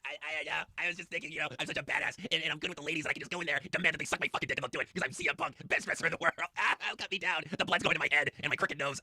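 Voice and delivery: hanging upside down, bragging wrestler parody; mock CM Punk. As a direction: strut through it like a cocky wrestler.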